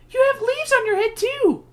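put-on high girl's voice. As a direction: voice young Margaret up high. Margaret voiced in story.

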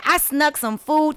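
ordinary talk, nothing marked

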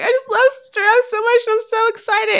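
high-pitched mock weepy gush; crying with joy. Imitating a girl who met the giraffes, tearful gushing. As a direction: imitate an overcome fan near tears. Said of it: "she was crying".

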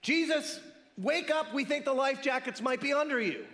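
hammed-up alarm, waking him up; mock panicked shout. Mock plea, comic.

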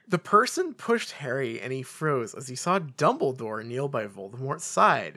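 usual speaking voice

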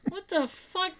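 stunned by what's on TV; incredulous. Retold or imitated. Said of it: "staring at the T V going".

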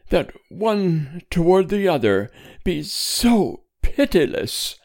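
high-pitched, pained exasperation; slow, emphatic reproach. A narrator's reading. Wife reproaching her stern husband, slow emphatic reproach. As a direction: lean on the final syllables, exasperated. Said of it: "pronounced slowly, with emphasis on the final syllables".

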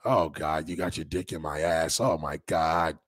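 put-on a deadpan older lover. A comic imitating an older woman in bed, understated. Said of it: "She be like".

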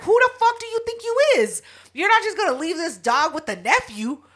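high-pitched indignant telling-off; mock outrage. Calling Pierre immediately about the dog, high indignant retelling. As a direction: chew him out, pitch shooting up. Retold angry call to Pierre.

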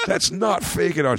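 high-pitched mock outrage; retold furious mom. Mom discovering the tattoo is real, mock-furious. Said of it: "She lost her shit on me".

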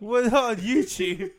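loud laughing protest. Real, not acted. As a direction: laugh through it, loud and slow.

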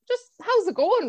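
ordinary delivery

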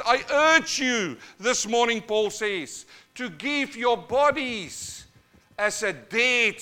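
high, loud pulpit exhortation; full-force preaching. Acted. Preacher begs his churches to give their bodies, loud impassioned exhortation. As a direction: urge the congregation at the top of your voice.

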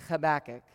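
"Habakkuk" with a guttural ch at the start; Hebrew pronunciation. Shown as a demonstration. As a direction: scrape the throat into "Habakkuk". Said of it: "So there you go".

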